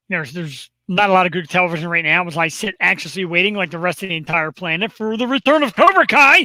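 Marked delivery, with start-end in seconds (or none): 4.9-6.4 higher, louder fanfare delivery; hyped announcement. Real, not acted. Host hyping a favorite show's return, excited. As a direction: trumpet it like a big reveal. Builds up hype for show.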